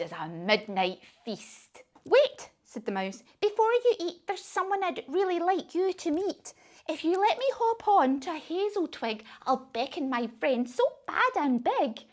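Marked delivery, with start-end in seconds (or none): 2.0-2.5 high, loud little mouse; tiny mouse crying out to stop him; a high squeaky mouse calls wait. Acted. Mouse stops the Gruffalo's child. 3.4-12.0 high, sly little mouse; squeaky mouse spinning a clever trick; high mouse voice bargaining with the child. Acted.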